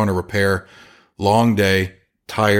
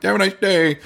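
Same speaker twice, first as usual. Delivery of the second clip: high chirpy goodbye; customer's cheery goodbye, high and bright; mock cheery sign-off; 11 semitones higher than usual. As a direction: sing it out like a breezy customer.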